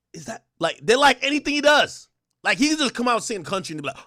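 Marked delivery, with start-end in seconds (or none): 0.1-0.5 breathy awed gasp into a question; stunned fan gasping mid-question. Retold or imitated. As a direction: gasp like hearing something holy. Awed fans hearing [name].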